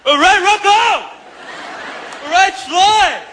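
pumped-up yelling fan; excited shout. Retold or imitated. Hyped moviegoers shouting outside theater.

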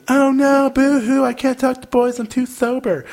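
mock whining. Mocking sisters who say they cannot talk to boys, whiny. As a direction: whimper it like a sulky sister. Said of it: "that have told me".

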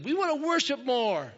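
high, loud plea from the crowd; imitated kid's call-out. Kid calls out to worship leader.

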